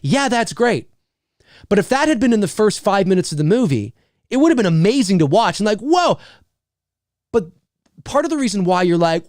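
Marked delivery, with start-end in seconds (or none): 5.8-6.2 short, high whoa that falls; mock amazement; wowed viewer, briefly; awed reaction.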